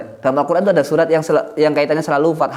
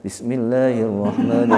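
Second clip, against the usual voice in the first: membaca pelan; 4 semitones lower than usual. Shown as a demonstration. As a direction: recite it unhurriedly, low and measured. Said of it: "baca pelan-pelan aja". (membaca mengaji pelan-pelan dengan nada rendah)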